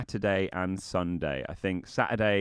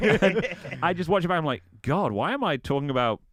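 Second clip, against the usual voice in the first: laughing; 7 semitones higher, 4 dB louder than usual. Real, not acted. Laughing at own rap.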